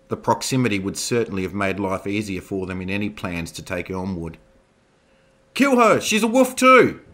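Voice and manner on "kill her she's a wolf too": high, loud screaming from the gallery; furious heckler shout. Acted. Heckler screaming at the accused in court, shrill fury.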